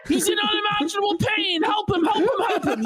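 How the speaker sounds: high, hammed-up plea for help; mock alarm. Host pleading for a supposedly suffering YouTuber, mock-frantic. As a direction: beg for rescue in fake panic.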